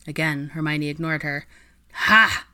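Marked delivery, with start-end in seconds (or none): 1.9-2.4 loud triumphant ha. Acted. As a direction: crow in victory. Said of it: "Ginny said triumphantly".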